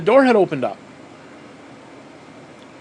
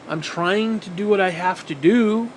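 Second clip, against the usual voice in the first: normal talking voice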